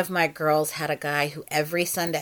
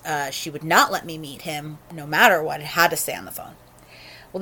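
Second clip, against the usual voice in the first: usual speaking voice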